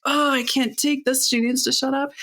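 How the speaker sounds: retold inner outburst, at the end of patience; exasperated. Mother fuming to herself over the dishes as daughter yells, exasperated.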